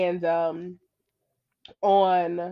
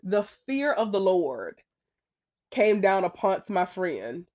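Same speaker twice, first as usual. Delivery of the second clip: ordinary delivery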